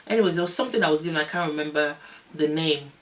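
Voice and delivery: ordinary talk, nothing marked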